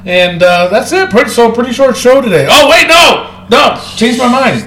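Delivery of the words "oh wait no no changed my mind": raised, higher-pitched protest; sudden excitable objection, pitched up; mild yelling. Real, not acted. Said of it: "Stop yelling".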